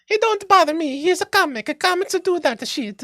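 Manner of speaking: very high little Mario voice; squeaky cartoon plumber impression; Robert Tassoni. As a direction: pipe it up in comic falsetto. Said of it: "That's a Robert voice".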